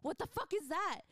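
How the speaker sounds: mock alarm, rising on the repeat; imitated freak-out. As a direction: act out someone startled awake. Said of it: "freaked out before".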